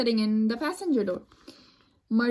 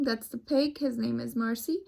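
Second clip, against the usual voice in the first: ordinary delivery, nothing marked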